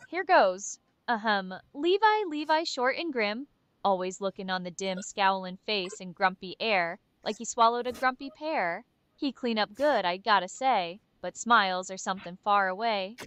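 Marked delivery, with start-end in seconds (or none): none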